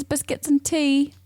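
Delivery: put-on a slow, light try at a British accent; a hint of a British accent; British accent attempt. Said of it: "That's as good as I get".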